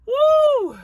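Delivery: loud high whoop; high woo. Real, not acted. Pet mom exclaiming about her dog, loud high whoop. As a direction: let out a giddy whoop.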